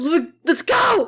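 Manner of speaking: high, drawn-out pep-up shout; slow, rousing holler; shouted hype. Real, not acted. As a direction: yell it to get everyone hyped. Shouted hype before recording.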